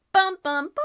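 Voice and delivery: high, loud dun dun dun; dramatic sting. Acted. Game master teasing the players with a loud dramatic sting. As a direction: sing the suspense sting. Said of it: "dramatic sting".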